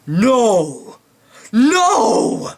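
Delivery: hammed-up anguished cry; mock Vader scream. Imitating Darth Vader crying out as he saves Luke. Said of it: "he then screams".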